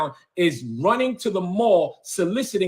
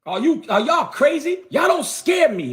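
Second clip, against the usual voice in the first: defiant shout; 8 semitones higher than usual. Real, not acted. Challenging the church crowd that they don't scare him, shouting.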